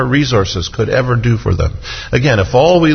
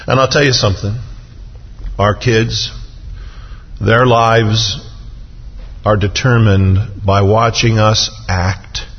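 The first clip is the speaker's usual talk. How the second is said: ordinary talk, nothing marked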